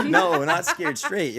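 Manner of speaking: high, slow, laughing denial. Real, not acted. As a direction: laugh through the no. Laughing off a wrong guess.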